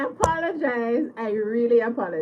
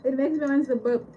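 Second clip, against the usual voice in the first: normal talking voice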